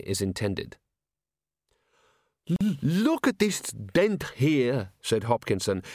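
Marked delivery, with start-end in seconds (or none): 2.5-4.9 squeaky, slow, fussy little man; prim, stammering museum curator. Acted. Ghost curator speaks.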